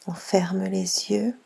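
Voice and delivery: ordinary talk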